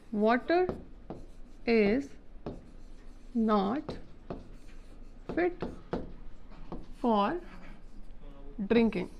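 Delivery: long pauses between every word; "Water... is... not... fit... for... drinking", each word isolated. Shown as a demonstration. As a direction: dictate it slowly, one word at a time. Writing out the example sentence.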